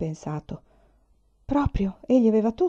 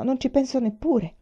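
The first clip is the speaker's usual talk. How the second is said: a hint of stammering, pitched higher; mildly flustered stammer; 6 semitones higher than usual. A narrator's reading. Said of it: "balbettò". (balbettio appena accennato, più acuto)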